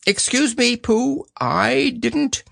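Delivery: high, drawn-out protest; Owl. Acted. Owl again denies sneezing.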